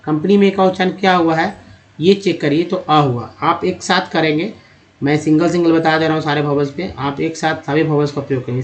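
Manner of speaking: normal talking voice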